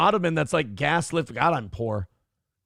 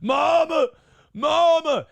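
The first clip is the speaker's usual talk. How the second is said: high, rising loud cries of mama; mock dying cry; 11 semitones higher, 5 dB louder, slower than usual. Mocking a dying woman's last words, high and loud. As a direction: cry mama louder each time in mock anguish. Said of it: "her last words were".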